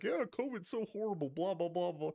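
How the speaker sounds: high, mocking preachy voice; sarcastic high mimicry; mock politician. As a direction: sneer at him with a hammed-up high voice. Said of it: "be like".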